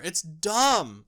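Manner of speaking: ordinary talk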